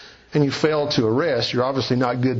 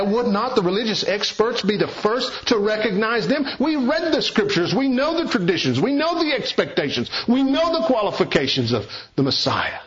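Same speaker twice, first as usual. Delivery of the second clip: pitched higher, smug religious experts; arrogant elitism; 7 semitones higher than usual. Acted. Preacher mimicking the Pharisees' boast, higher and arrogant. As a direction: boast "We know" like proud scholars. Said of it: "arrogantly implies their spiritual elitism".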